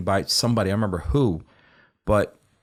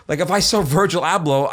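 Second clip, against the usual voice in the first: normal talking voice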